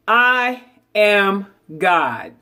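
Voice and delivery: very slow, each word set apart; slow affirmation. Shown as a demonstration. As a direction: lay it down slowly like a creed. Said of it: "And then say to yourself".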